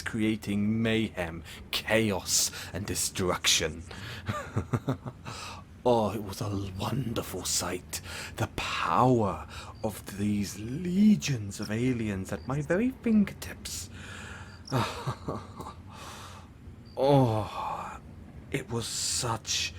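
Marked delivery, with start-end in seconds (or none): 3.9-5.6 villainous chuckle. Acted. Villain savouring mayhem and destruction, gloating chuckle. 14.7-15.7 soft villainous chuckle; gloating chuckle. Acted. Villain remembering the alien legions, gloating chuckle. Villain savours own plan.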